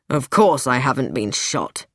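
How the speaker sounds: low-pitched, irritated denial; slightly angry. A narrator's reading. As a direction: deny it with a little anger. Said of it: "with a little show of anger".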